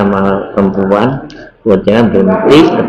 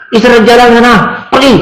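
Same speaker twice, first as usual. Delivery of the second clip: a much higher, mock-harsh order to go away; imitated rude dismissal; 12 semitones higher, faster than usual. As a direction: play the annoyed homeowner shooing off strangers. Homeowner chasing them away.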